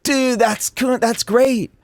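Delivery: high-pitched imitation of gushing listeners; mock fan voice. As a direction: mimic an impressed fan, pitched well up. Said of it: "all his listeners are like".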